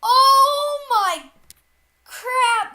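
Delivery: high, loud amazed outburst; shocked shout. Real, not acted. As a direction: blurt it out in shock. Sees brutal game effect.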